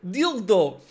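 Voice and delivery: mock Russian accent on Dildo. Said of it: "but in a Russian accent".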